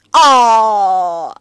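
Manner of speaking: short loud cry of pain; dying scream. Acted. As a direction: cry out as the staff hits. Demon struck by the staff.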